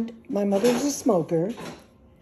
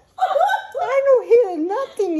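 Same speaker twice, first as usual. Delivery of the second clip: slow, high and louder through laughter; laughing; 13 semitones higher, 5 dB louder, much slower than usual. Retold or imitated. Batista denying he heard the crash, laughing. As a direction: squeeze it out laughing.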